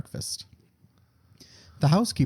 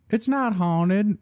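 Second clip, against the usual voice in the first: high, slow housekeeper voice; slow high drawl for the maid; 6 semitones higher, much slower than usual. Acted. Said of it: "I already fucked it up".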